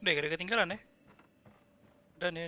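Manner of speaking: ordinary talk, nothing marked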